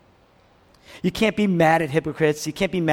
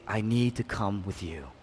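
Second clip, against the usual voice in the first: tender Jesus; 9 semitones lower, 9 dB quieter than usual. Acted. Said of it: "Hear Jesus say".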